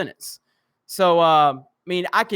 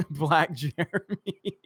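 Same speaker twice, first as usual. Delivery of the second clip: slow, soft words squeezed through laughter; laughing; 3 semitones lower, 7 dB quieter, slower than usual. Real, not acted. Streamer reading viewers' impression requests, laughing. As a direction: read it out while cracking up.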